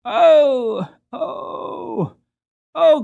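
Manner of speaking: high pained cries of oh; crying out. Acted. Boy stuck in the thorn hedge, crying out in pain. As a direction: yelp in pain among thorns. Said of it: "cried the boy".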